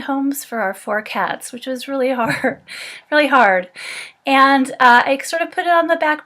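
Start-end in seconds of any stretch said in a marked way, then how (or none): 2.9-3.7 laughing through it, a bit louder; rueful laugh. Real, not acted. Telling a friend about giving up the cats, laughing ruefully. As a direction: laugh it off as you repeat. Repeating it, second time laughing.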